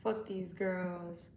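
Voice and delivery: mocking a viewer's bravado; mock tough guy. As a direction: put on a sullen tough act. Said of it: "Now he's like".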